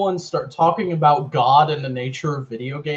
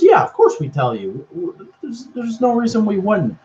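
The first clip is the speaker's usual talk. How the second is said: evasive reassurance; 8 semitones higher than usual. Retold or imitated. Acting a character's evasive answer.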